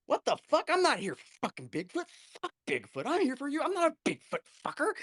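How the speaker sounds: high-pitched indignant outburst, acted out; Tom King. Teller imitating a colleague storming up on set, indignant. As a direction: mimic an exasperated man protesting. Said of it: "Tom King comes up and goes".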